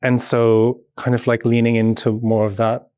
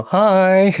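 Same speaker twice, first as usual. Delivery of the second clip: bright, higher, louder greeting, cheerful; mock-sunny greeting to the crew; bright chirpy hello; 8 semitones higher, 4 dB louder than usual. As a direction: breeze in beaming with a big hi. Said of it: "filling it with brightness and joy".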